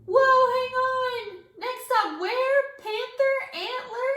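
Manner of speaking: high, slow, loud startled whoa; surprised. A narrator's reading. Child startled by teacher's odd destination, high surprised voice. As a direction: pull up short in confusion. Child confused by strange word.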